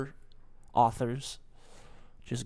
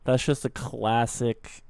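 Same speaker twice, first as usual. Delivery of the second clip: ordinary talk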